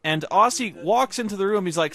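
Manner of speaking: ordinary talk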